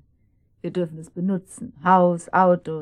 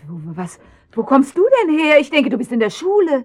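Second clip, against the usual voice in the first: higher, louder, rushed; flustered surprise; 5 semitones higher, 7 dB louder, faster than usual. Acted. Mother caught kissing, flustered questions to her daughter. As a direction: blurt it out, caught off guard.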